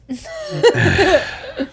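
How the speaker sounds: squealing high laugh. Real, not acted. Laughs at question.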